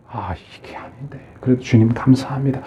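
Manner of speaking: hushed, grudging whisper to God; a quiet inner whisper; whispered reluctant thanks. Pretend. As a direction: whisper it, half-resigned.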